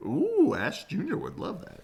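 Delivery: high, bright-eyed ooh of delight; gushing character aside; delighted coo. Acted. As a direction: coo it like a thrilled kid.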